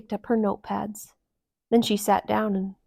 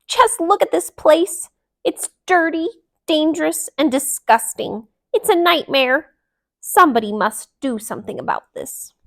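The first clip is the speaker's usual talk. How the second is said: loud, high indignant complaint; outraged; 7 semitones higher, 6 dB louder than usual. A narrator's reading. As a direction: rant at the mess. Delilah outraged at junk.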